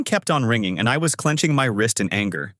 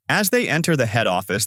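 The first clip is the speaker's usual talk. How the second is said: ordinary delivery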